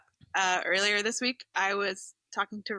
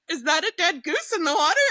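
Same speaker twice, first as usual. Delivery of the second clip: higher and louder, startled at what she sees; alarmed discovery; 7 semitones higher, 5 dB louder than usual. Retold or imitated. Telling friends about the walk, alarmed at the dead goose. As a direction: gasp out the question as if you just spotted it.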